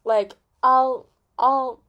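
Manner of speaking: hammed-up loud pledge; loud mock announcement. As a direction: boom it out like a proud messenger. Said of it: "nitrobenzene's like".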